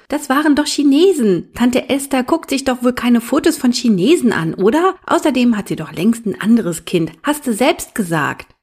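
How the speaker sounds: put-on high, loud, protesting child voice; defiant little boy. A narrator's reading. Little brother arguing with his big brother, indignant. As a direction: argue back like an indignant small boy.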